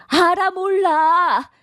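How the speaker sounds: high, slow, drawn-out demand; slow pressing demand. Acted. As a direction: stretch each word to corner her. Mother-in-law presses daughter-in-law.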